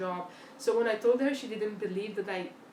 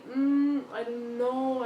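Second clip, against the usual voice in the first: unsure drawn-out hmm, then a shrug; hesitant umming; 4 semitones higher, 6 dB louder, much slower than usual. Retold or imitated. Candidate stalling in an interview, hesitant. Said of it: "to stay and say".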